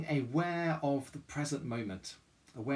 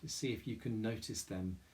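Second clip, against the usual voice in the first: soft, calm meditation voice with long pauses; guided meditation; 3 semitones lower, 5 dB quieter than usual. Real, not acted. Chapel leader guiding listeners through mindful breathing, guided meditation. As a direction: guide them gently inward, leaving room to breathe.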